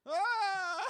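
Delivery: a big, high, drawn-out theatrical ah; Orson Welles ah. Shown as a demonstration. Friend demonstrating the Welles opening, a grand ah. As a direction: open with a grand booming ah. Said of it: "You gotta have that, that first beat".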